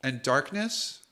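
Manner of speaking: hushed, unhurried scene setting; game master narration. Acted. GM to players, setting the death scene, hushed. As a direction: draw the players into the dark.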